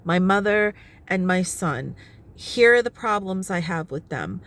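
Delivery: usual speaking voice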